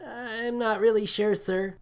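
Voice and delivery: awkward and blushing; embarrassed crewman. A narrator's reading. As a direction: crewman answering, mortified.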